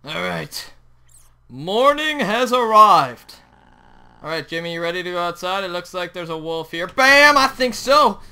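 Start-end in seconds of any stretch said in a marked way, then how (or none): 1.5-3.4 slow, loud proclamation; high, drawn-out herald's call; mock-grand announcement. Grandly announces the new day. 6.9-8.2 high, loud exclamation; punchy combat outburst; cocky battle cry. Real, not acted. Attacks a wolf in game.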